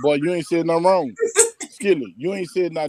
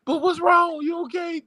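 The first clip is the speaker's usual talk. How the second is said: put-on much higher, little-girl voice asking innocently; imitating granddaughter; 12 semitones higher than usual. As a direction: mimic the grandbaby's high, innocent question. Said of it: "She said".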